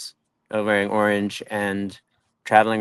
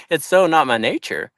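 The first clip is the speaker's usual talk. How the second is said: normal talking voice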